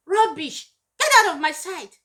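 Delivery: loud, high angry dismissal; dismissive shout. Retold or imitated. Parent driving a child away, loud and angry. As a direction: bark them out of your sight.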